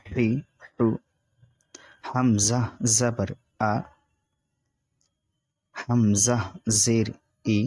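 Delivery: measured teacher recitation with long pauses; patient classroom drill; slow spelling drill. Shown as a demonstration. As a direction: spell each syllable out for students to repeat.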